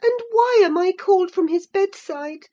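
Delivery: high, loud impatient questions; indignant demand. A narrator's reading. Catherine demanding an explanation from Linton. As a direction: press him hard for the truth.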